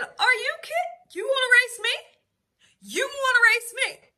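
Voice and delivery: put-on husband laughing off the child's race dare; mock husband. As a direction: scoff at the boy's challenge. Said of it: "And my husband said".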